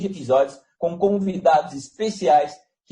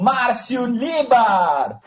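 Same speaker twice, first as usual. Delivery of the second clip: slow, rising circus announcer call; ringmaster; 7 semitones higher, much slower than usual. Acted. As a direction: build it up like a ringmaster.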